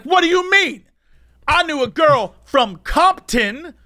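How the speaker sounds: high, loud protesting holler; indignant drunk, drawing the words out high; imitated yelling. Drunk man answering the security guy, high mock yell. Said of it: "keep yelling at each other".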